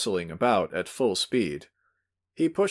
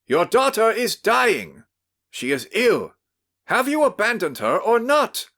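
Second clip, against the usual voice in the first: shouting; 13 semitones higher, 6 dB louder than usual. Acted. Host shouts at the father about his dying daughter. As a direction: bellow the accusation over him. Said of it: "went on shouting without heeding him".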